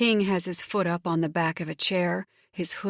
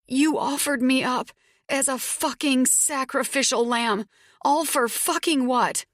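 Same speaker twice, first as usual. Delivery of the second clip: hurt angry accusation; 7 semitones higher than usual. A narrator's reading. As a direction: demand it through tears. Tears spill, accusing him.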